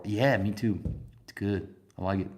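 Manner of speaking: normal talking voice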